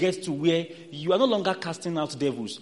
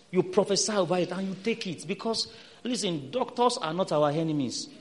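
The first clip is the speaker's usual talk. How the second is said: ordinary talk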